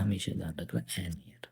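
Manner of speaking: whispered reminder; teacher whispering a tip. Shown as a demonstration. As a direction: drop to a whisper for the rule. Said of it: "teacher whispers a rule".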